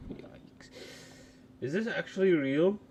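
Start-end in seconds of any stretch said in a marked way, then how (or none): 0.1-0.7 breathy whispered yikes; a hushed, cringing yikes. Real, not acted. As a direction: breathe the word out with no voice. Whispered reaction.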